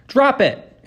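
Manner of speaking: high, loud dog command; ordering. A narrator's reading. Girl to dogs fighting over a ball, ordering. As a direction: order the dog firmly and loudly. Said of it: "Annabeth ordered".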